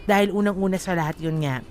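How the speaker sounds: ordinary talk, nothing marked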